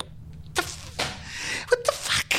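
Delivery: high strangled exasperation; squeaky disbelief. Real, not acted. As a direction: squeeze it out in disbelief.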